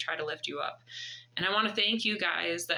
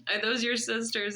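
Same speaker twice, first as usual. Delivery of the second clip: ordinary talk, nothing marked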